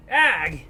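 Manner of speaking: loud short grunt of effort; a grunt while struggling; pained grunt. Acted.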